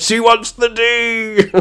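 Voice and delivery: high, slow hooting chant; high drawn-out mock chant; mock gleeful cheer. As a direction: crow it out like a jeering crowd. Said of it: "they all just went".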